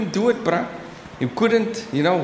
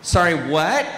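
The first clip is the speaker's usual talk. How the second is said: confused American; 3 semitones lower, slower than usual. Acted. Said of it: "speak to them in their own accent".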